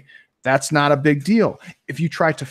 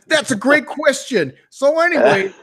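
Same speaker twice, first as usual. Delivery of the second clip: much higher mock coach voice; chirpy pitched-up imitation of a coach; Coach Steve impression; 11 semitones higher than usual. Friend mimics the podcast guest's coach voice. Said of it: "sounded just like Coach Steve".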